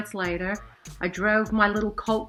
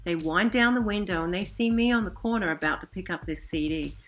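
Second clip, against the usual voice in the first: ordinary talk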